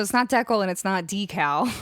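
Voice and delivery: first "decal" as DECK-ul, second "decal" as DEE-kal; two rejected pronunciations. Shown as a demonstration. Streamer ruling out both versions, "decal" as DECK-ul, then DEE-kal. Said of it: "It's like decal".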